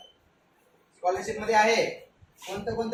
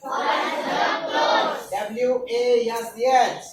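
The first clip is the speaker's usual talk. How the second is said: sing-song answer; 2 semitones higher, 4 dB louder, slower than usual. Shown as a demonstration. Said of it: "काय म्हणतात?".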